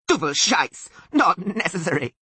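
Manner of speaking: high, loud bleated curse; bleating Sylvan. A narrator's reading. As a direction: object angrily in a goat-like bleat. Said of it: "bleated even louder".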